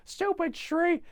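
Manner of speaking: put-on very high, loud angry kid; mock tantrum. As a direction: scream it like a furious child. Child tantrum.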